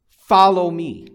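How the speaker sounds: loud, high, drawn-out order; ringing command with an exclamation point; emphatic command. Acted. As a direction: call it out slowly with full force. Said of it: "It's emphatic".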